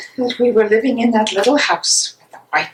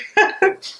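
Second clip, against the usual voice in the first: short high giggle of delight; light, high little laugh; 11 semitones higher than usual. Acted. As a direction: laugh at the sweet memory. Fond memory of the grandmother.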